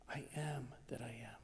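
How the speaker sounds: awed whisper of the divine name. Retold or imitated. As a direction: breathe it out in a hush. Voicing God to Moses.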